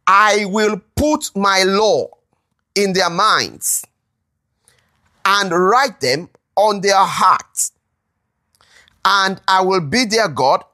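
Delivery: slow, weighty reading, a pause after each phrase; solemn scripture reading. Acted. Preacher reading God's promise to the congregation, slow and solemn. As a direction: let each promise land slowly.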